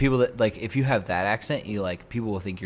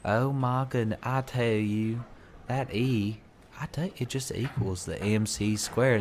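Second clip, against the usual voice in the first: put-on sophisticated Southern accent; 3 semitones higher than usual. Gentleman explaining physics in a sophisticated Southern accent. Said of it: "the sophisticated Southern accent".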